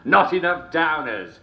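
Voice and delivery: punchline pushed out through laughter; giggling as you deliver the punchline; laughing punchline. Real, not acted. Host cracking up at his own joke, laughing.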